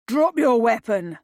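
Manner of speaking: loud barked command; barked order. A narrator's reading. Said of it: "Ludlow barked".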